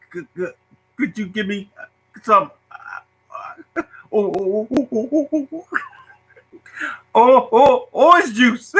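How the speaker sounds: put-on high, stuttering kid straining to get the words out; mock his younger self stuck on "oh" before "orange juice"; imitating his stutter.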